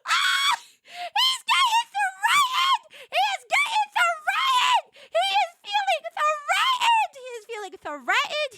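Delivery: extremely high, loud screaming squeal; gleeful shriek. Real, not acted. Wife on husband jealous of game men, shrieking. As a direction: scream every word at the top of your range.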